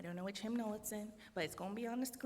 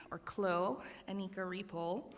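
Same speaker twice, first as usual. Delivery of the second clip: ordinary talk, nothing marked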